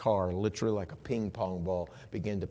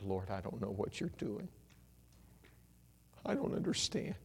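soft, half-whispered plea; hushed bewildered prayer; 9 dB quieter than usual. Acted. Believer looking up at the Lord, confused, hushed. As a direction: murmur it up to God.

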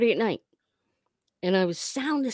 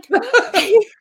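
loud, high-pitched laugh; burst of laughter; 11 dB louder than usual. Real, not acted. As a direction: crack up at your own punchline. Laughs at own punchline.

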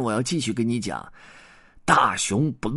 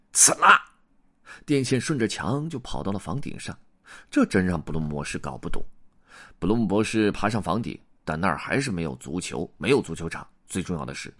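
plain reading voice

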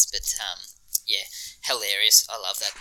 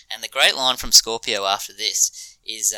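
normal talking voice

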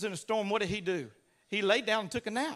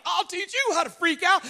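out-freak everyone, pitched way up; mock freak-out; 7 semitones higher, 4 dB louder than usual. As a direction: escalate high into showy panic. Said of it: "if someone's freaking out".

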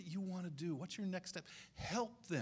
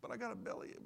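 put-on small, higher, softer kid voice; mock begging, soft and high; pleading child; 6 semitones higher, 5 dB quieter, faster than usual. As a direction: imitate a child's feeble excuse. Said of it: "I used to beg".

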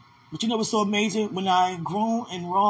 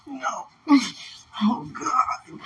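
crying; 5 semitones higher, much slower than usual. Real, not acted. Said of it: "you be whispering, crying".

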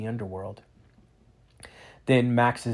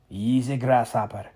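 put-on Scottish accent; 5 dB louder than usual. A narrator's reading. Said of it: "the Scotsman said".

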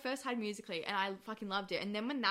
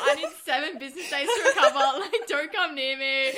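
mock dramatic; 3 semitones higher, 11 dB louder than usual. Joking about bad rating.

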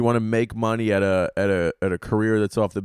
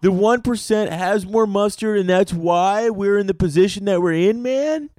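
hippie voice; 10 semitones higher, 3 dB louder than usual. Retold or imitated. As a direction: play a spaced-out protester asking earnestly. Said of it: "And like".

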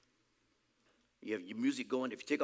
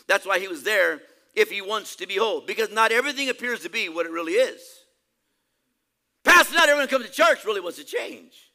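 loud, raised preacher's voice; higher, louder pulpit delivery; forceful preaching; 6 semitones higher, 12 dB louder than usual. Real, not acted. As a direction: press the question hard on the congregation.

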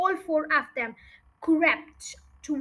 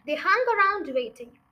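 usual speaking voice

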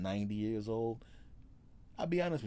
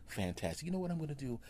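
ordinary talk

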